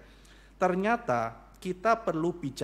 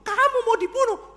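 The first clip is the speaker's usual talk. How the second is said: very high, astonished question; shocked king; 7 dB louder than usual. Acted. The king shocked that his wife will be killed. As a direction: gasp it in disbelief.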